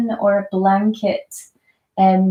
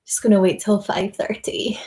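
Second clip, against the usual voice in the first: whispered aside to the room. Real, not acted. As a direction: drop to a breathy whisper, half to yourself. Repeats herself to new joiner.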